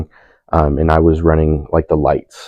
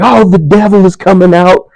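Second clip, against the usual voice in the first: high, loud mock preacher cry; imitating the preacher; 14 semitones higher, 8 dB louder than usual. Man praying over him, fervent mock preacher. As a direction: ham up a fervent faith healer. Husband's prayer shout voiced.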